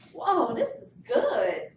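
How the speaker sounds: slow, loud, laughing whoa; delighted. Retold or imitated. Eight-year-old tasting lemon pie, delighted and laughing.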